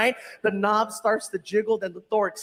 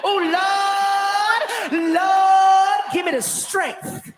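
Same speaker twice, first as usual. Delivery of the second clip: shouted, sung-out plea to the Lord, higher; mock prayer; 6 semitones higher, 4 dB louder, slower than usual. Delivery woman begging the Lord for patience, loud and high. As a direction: cry out to heaven in mock despair.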